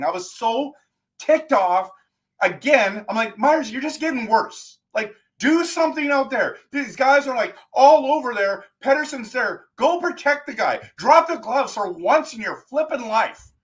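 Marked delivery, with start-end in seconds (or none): 3.4-13.5 high-pitched exasperated rant at the player; mad ranting. Retold or imitated. Fan ranting at Myers, high and exasperated. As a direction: rant at him like he can hear you, pitch way up. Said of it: "Can you tell I'm mad?".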